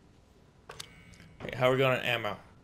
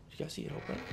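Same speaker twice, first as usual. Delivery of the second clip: whisper; 2 semitones higher, 14 dB quieter, much faster than usual. Real, not acted. Tense moment in dark room.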